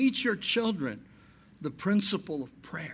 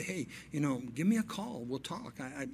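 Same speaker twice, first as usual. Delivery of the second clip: 1 gentle, soft offer; 2 semitones lower, 7 dB quieter than usual. Retold or imitated. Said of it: "just say".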